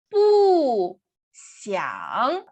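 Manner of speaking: Bu falling hard, xiang dipping; fourth then third tone. Shown as a demonstration. Teacher keeping fourth tone, Bu falls, xiang dips. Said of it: "Mantenemos el cuarto tono".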